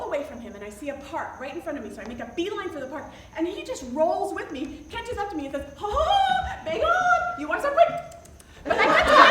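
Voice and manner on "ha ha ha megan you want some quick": high, loud, mock-French accent; excitable pursuer in a badly faked French accent. Frenchman catching up to the woman, bad French accent. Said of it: "I can't do a French accent".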